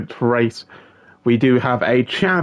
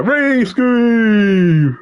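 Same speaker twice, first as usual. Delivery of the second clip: long, slow, higher call of the name; drawn-out name call; 9 semitones higher, 4 dB louder than usual. Real, not acted. Commentator hyping his co-commentator, drawn-out higher call. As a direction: stretch the name out like a hype announcer.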